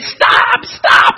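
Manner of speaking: loud, desperate yell; acted shout of agony; crying out. A narrator's reading. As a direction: scream it from inside the furnace. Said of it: "I cried".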